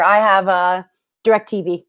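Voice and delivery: ordinary talk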